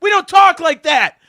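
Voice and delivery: high, loud mock telling-off; mock scolding. Fan silencing Chris for predicting a death, mock-outraged scolding. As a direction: scold a friend in hammed-up outrage.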